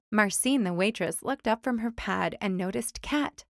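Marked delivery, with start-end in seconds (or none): none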